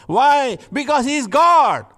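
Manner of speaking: top-of-the-lungs high preaching shout; a loud, high cry proclaiming God; shouted climax. Acted. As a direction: hurl it out as the peak of the sermon. Climax of the sermon.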